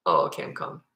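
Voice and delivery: very low, sarcastic fake calm; deadpan pretend obedience; mock compliance. Sarcastic reply to being told calm.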